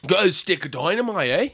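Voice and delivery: badly faked Australian pitch for dynamite; attempted Australian accent. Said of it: "That's your Australian accent?".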